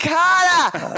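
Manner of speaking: high-pitched Boston yell at a machine; mock yell. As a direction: yell the name at an automated operator. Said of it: "You can't yell at an automated machine".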